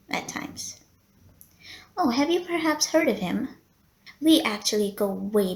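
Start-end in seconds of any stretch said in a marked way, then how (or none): 0.1-0.8 softly whispered trailing end; whispered aside. Acted. Receptionist finishing gossip about Mr Helsing, whispered. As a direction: drop to a whisper.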